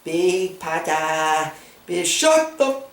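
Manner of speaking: high, slow silly mimicry; shrill-sounding parody of her lines; mock annoying voice. Mocking character's annoying voice.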